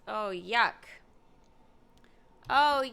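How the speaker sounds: normal talking voice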